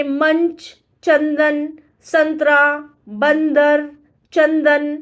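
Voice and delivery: मंच, चंदन, संतरा, बंदर each with a firm न before the next consonant; clear n sound. Shown as a demonstration. Teacher lists anusvara words and stresses the न sound. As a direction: sound each word so the न is heard. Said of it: "न की ध्वनि आती है".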